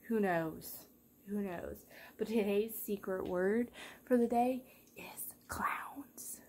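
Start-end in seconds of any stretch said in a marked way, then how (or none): 2.2-6.4 slow conspiratorial whisper; secret whisper. Real, not acted. Secret word whispered to viewers.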